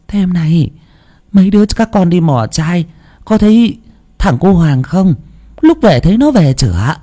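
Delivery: lightly voiced grandmother; grandma asking. A narrator's reading. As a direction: question the grandson calmly.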